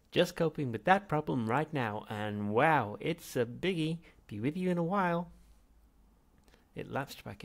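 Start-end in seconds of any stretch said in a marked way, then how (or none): 0.1-5.3 loud, high, cheery computer voice; breezy and overeager like a machine; chirpy computer. Acted. The ship's computer cheerily reports on the tea problem.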